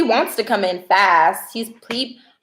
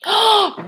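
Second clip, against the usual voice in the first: surprised gasp; 12 semitones higher than usual. Real, not acted. Surprised by chat message.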